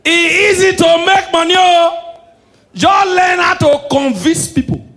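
high, full-voiced shout; loud proclamation. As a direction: belt it out to the whole crowd. Proclaims how easy money is.

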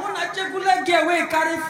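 ordinary talk

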